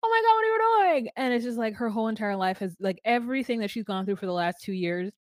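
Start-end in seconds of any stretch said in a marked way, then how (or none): 0.0-1.1 much higher and louder, stunned disbelief; shocked high squeal at the proposal; shocked shriek. Retold or imitated. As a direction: squeal it like you just caught him kneeling. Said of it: "and she's like".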